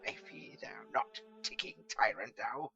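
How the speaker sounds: loud slow scornful challenge; defiant wizard scorning the clock; contemptuous defiance. A narrator's reading. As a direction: sneer at the clock. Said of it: "snapped his fingers contemptuously".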